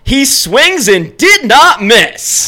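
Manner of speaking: slow, high broadcaster call; home run call. Retold or imitated. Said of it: "So, this was his home run call".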